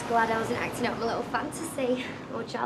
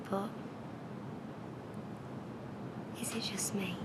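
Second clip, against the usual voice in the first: soft, low, breath-led confession; intimate seductive whisper; 4 semitones lower, 9 dB quieter than usual. Acted. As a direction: whisper it close, with long pauses. Woman seduces reluctant man, close up.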